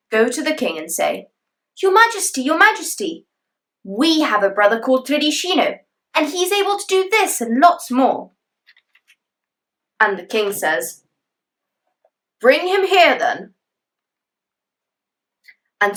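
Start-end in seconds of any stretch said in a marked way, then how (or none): 1.8-8.3 eager brothers. Acted. Brothers pleading with the king. 12.4-13.5 king's command. Acted. King commanding.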